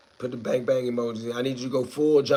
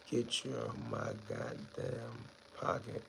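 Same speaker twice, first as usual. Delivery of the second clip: hushed signal; 3 semitones lower, 17 dB quieter, slower than usual. Pretend. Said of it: "acted robbery signal".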